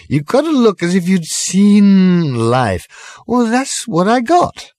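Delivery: put-on high, slow, drawn-out drunken pronouncement; pompous drunk speech. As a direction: lecture the pub grandly, slowly.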